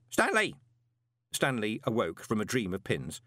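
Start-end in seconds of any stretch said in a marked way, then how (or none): 0.1-0.6 name called high, falling sharply; high, emphatic summons; insistent call. A narrator's reading. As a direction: call the lad out of his daydream. Calls again, getting no answer.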